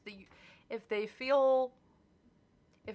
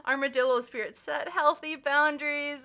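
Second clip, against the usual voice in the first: louder, higher card announcement; proclaimed advice; 6 semitones higher, 7 dB louder than usual. Real, not acted. Reading the tarot advice to viewers, proclaimed louder and higher. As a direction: announce the card's advice with emphasis. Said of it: "That's the advice".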